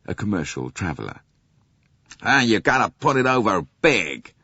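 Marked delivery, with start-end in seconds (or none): 2.2-4.3 loud, higher brash salesman; loud nasal American. A narrator's reading. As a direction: push it out like a brassy travelling salesman. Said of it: "in a loud, nasal voice".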